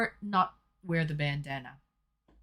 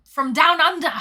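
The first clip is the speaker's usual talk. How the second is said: shouted Australian accent; 4 semitones higher, 8 dB louder than usual. Shown as a demonstration. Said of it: "I don't know why I shouted".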